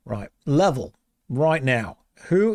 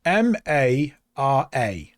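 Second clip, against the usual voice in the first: slow letter-by-letter spelling; spelled out slowly; 2 semitones lower, much slower than usual. Shown as a demonstration. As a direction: spell each letter out deliberately. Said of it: "Look at the letters".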